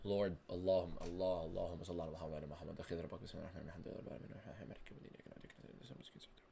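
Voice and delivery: fading to a whispered prayer, quickly; fast murmured prayer. Real, not acted. Streamer praying for his fighter, murmuring fast.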